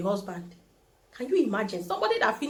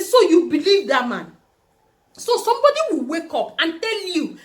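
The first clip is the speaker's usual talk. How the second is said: incredulous challenge; 6 semitones higher, 7 dB louder than usual. Retold or imitated. Challenging her mother.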